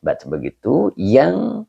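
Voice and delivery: ordinary talk, nothing marked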